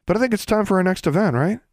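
put-on high, quick, eager kid; high youngster voice. A narrator's reading. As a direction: rush it out in a high child's voice. Magnificent, a youngster, keeps high voice.

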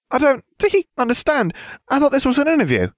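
very high, quick, airheaded voice; squeaky airhead voice; ditzy blonde witch. A narrator's reading.

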